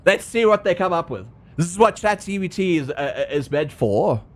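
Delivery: ordinary talk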